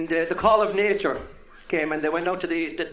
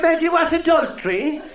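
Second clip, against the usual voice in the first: put-on old Cork woman; 11 semitones higher, 6 dB louder than usual. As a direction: call over the toilet partition. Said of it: "She said".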